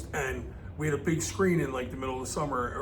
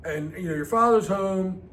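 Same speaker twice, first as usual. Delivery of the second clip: mom calling out; 4 semitones higher, 5 dB louder than usual. Retold or imitated. Mom calling kids in for dinner, hollering.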